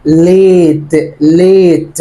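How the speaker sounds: ليت drawn out very slowly, a said like ay; reading late aloud. Shown as a demonstration. Teacher reading ليت slowly for the class. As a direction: stretch ليت slowly with the ay sound. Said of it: "طب هنا".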